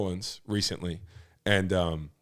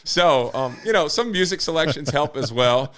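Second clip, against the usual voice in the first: louder and higher, fired up; hyped up; 6 semitones higher, 7 dB louder than usual. Real, not acted. Guest fired up by his own mass chant, hyped. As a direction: let the excitement push the voice up. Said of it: "I just got hyped now".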